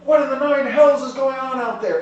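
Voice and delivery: loud, high-pitched angry yell; captain bellowing in alarm; shouting. Acted. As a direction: shout it out the door. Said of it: "he's shouting as he's going outside".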